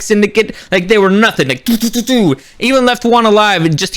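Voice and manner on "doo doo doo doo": quick, high blaster noises; imitated gunfire. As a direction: pop out rapid shots with the voice. Imitates rapid gunshots.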